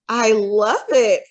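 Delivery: slow, loud hooting laugh line. Acted. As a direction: hoot it out slowly, delighted. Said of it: "Fred hooted".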